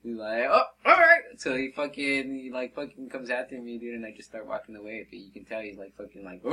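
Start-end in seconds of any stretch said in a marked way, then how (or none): 0.5-1.2 very high, loud imitation; mock indignation. Fisherman told he may have to leave, mock indignation. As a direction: blurt it out sharply as the offended man.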